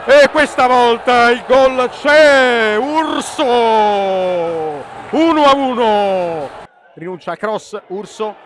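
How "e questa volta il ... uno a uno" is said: slow, loud, high celebration; jubilant call. Real, not acted. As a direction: savour the equaliser loudly. Celebrating the equaliser.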